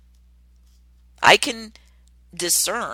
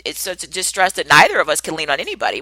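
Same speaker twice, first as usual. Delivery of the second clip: ordinary delivery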